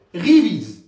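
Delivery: révise barked out loud as a command; father's order. Shown as a demonstration. Teacher playing a father ordering his child to revise, loud. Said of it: "Mon père m'ordonne".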